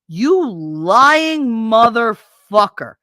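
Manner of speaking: loud furious insult; slow, loud enraged curse. Real, not acted. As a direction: spit it out slowly, enraged. Ranting at ex-husband.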